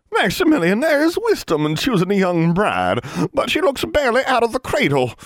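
put-on high, haughty old woman; disapproving old crone. A narrator's reading. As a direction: look down on the girl.

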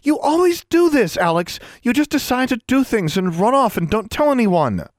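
high, loud angry tirade. A narrator's reading. Roommate fed up at being left out, angry. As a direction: let exasperation burst out. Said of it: "Anna said angrily".

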